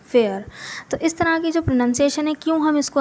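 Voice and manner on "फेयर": फेयर said slowly as fay-uh-r with a gliding vowel; teacher modelling फेयर for students; fair vowel demo. Shown as a demonstration. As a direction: draw out फेयर as a clear fay-er glide. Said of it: "इसी ए को हम ए आ से भी pronounce करते हैं".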